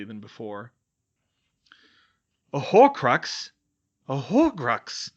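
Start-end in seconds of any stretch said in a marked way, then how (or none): 2.5-3.5 slow, loud, shocked; gasped. Acted. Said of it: "Ron gasped". 4.1-5.1 gasped echo. Acted. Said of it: "Ron gasped".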